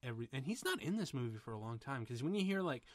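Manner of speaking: normal talking voice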